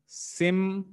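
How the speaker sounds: "Sim" held as a lone syllable. Shown as a demonstration. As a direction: sound out "Sim" slowly, on its own. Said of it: "How many vowel sounds are in simplification".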